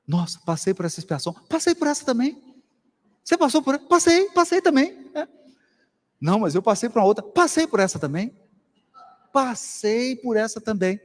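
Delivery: mock eager chatter. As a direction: play excited spirits swapping stories. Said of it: "O espírito puro fala assim".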